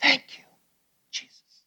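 imitated faint, straining whisper. Mute man first trying to speak, whispering. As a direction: barely get the words out, breathy and halting. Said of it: "with a whisper".

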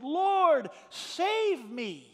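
a sinking man's cry for rescue. Retold or imitated. Pastor voicing Peter crying out to the Lord, high loud plea. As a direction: Lord high and loud, then a pleading save me. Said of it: "Peter cried out".

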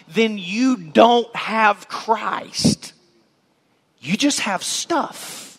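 very slow, weighty close; slow emphasis. Real, not acted. Preacher warning listeners they only have stuff, slow and weighty. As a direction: lean on each word slowly. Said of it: "preacher's pointed conclusion".

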